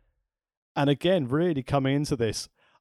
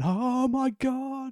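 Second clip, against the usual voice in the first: awed gasp; 13 semitones higher, much slower than usual. Retold or imitated. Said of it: "nudging their mate going".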